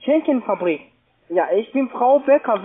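ordinary talk, nothing marked

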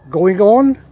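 normal talking voice

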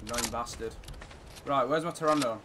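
normal talking voice

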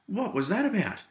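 high, loud startled "whoa", then baffled; stunned reaction. Retold or imitated. Retelling the group's reaction to the host, startled and baffled. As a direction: react like you just got ambushed. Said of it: "we all looked at each other and went".

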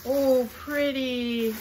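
loud, high, very drawn-out admiration; delighted coo. Real, not acted. Collector admiring a pretty dish, delighted coo. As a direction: draw it out in delight.

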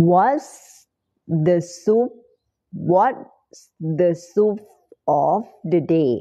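What's the soup of the day in slow chunks, rising on soup; slow drill. Shown as a demonstration. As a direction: chunk it slowly for learners. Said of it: "ก็ตามนี้นะ soup". (พูดช้าๆ ทีละช่วง)

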